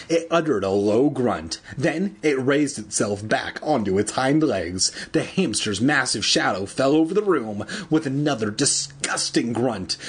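usual speaking voice

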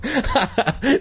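high, short acted laughter; mock laugh. A narrator's reading. Mother and children at a ridiculous idea, acted laughing. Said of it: "it made all three of them laugh".

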